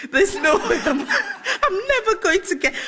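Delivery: high, loud, laughing through the words; laughing despair. Retold or imitated. Speaker retells her interview doubts, laughing despair. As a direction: crack up while acting hopeless.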